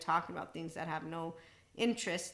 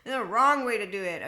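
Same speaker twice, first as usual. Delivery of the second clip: much louder, stressing "wrong" in mock scolding; loud parody of a rigid expert; mock know-it-all; 3 semitones higher, 11 dB louder than usual. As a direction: scold like a rulebook zealot. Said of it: "putting all their books on pedestals".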